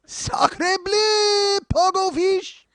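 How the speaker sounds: loud, high mock-French exclamation; over-the-top French accent. Host showing off accents, hammed-up French cry. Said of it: "all the magic I bring to those accents".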